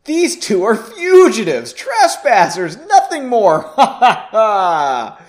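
high, loud, slow cackling dismissal; laughing mage. Acted. Lead mage laughs off the fugitives, high, loud cackling. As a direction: laugh them off as nothing. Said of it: "The lead mage laughed".

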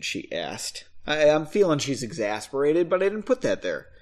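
ordinary delivery

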